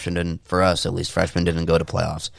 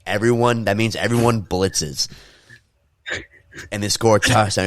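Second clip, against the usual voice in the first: ordinary talk, nothing marked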